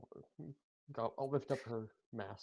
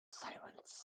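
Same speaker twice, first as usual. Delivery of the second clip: whispered; 9 dB quieter than usual. A narrator's reading. Dramatic narration beat.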